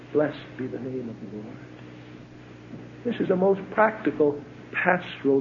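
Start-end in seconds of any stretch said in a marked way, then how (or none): 0.1-1.6 hushed reverence. Acted. Quiet close to Job's words.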